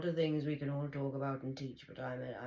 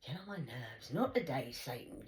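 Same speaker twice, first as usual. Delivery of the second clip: normal talking voice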